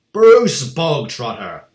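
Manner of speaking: a sudden, slow, very loud bark. A narrator's reading. Headmistress summoning a boy before the whole school, barking. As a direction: bellow the name across the hall. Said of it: "the Trunchbull barked suddenly".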